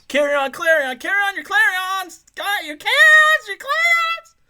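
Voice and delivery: very high, loud squeaky chant of carry-on; silly high voice. Pretend. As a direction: shriek it like a cartoon. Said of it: "that voice of mine".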